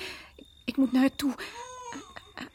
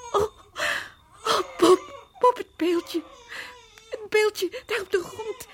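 higher, breaking with tears; tearful alarm; 8 semitones higher than usual. Acted. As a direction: panic over the statue. Vrouw ziet het beeldje. (hoog en huilend van schrik)

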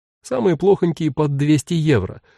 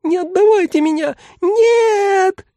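extremely high terrified squeal; mannequin's shrill wail of panic; frantic screeching; 4 dB louder, slower than usual. A narrator's reading. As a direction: screech hysterically in a tiny voice. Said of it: "истошно верещал манекен".

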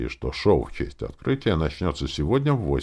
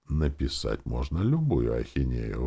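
ordinary delivery, nothing marked

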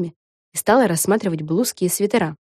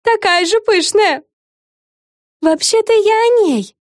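high, slow, sweet; cartoon princess; 5 dB louder, slower than usual. A narrator's reading. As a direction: correct her sweetly. Darcy corrects Fren. (мультяшная принцесса)

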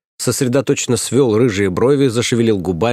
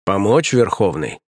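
knight's offer; slower than usual. Acted. Knight offers help from above.

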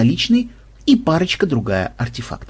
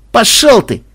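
higher, louder go-to-hell; louder rude shout; 9 semitones higher, 6 dB louder than usual. Acted. Captive shouts at his torturer, louder insult. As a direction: fling "Пошёл" higher and louder this time.

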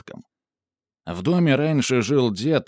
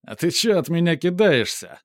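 street thug; 7 semitones higher than usual. A narrator's reading. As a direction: needle him like a tipsy thug. Drunk thug taunting Kirill.

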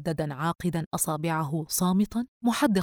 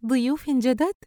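playful higher joking question; teasing; 7 semitones higher, 4 dB louder than usual. Acted. Friend joking on the phone about new guests, teasing. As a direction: tease her friend, higher and louder. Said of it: "دينا ممازحة".

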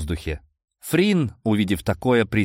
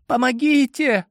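high pleading call; girl's cry for help; 3 dB louder than usual. A narrator's reading. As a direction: call out from behind the door. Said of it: "вновь раздался женский голос".

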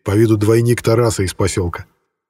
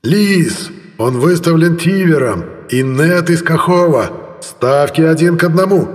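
higher, straining arena announcer; loudspeaker announcer; 7 semitones higher, slower than usual. A narrator's reading. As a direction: shout it over the loudspeakers. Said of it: "надрывались динамики".

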